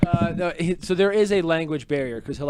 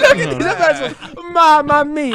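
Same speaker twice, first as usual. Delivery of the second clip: high, loud, over-the-top Italian voice; hammed-up Italian accent; 11 semitones higher, 8 dB louder than usual. Friends riffing on the boss, loud mock Italian accent. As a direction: ham up a cartoon Italian baker. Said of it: "that's not a good accent".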